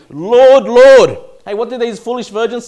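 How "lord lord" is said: loud, desperate cry of Lord, Lord; pleading cry. Acted. Preacher voicing the foolish virgins, loud pleading. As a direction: cry out begging at the gate.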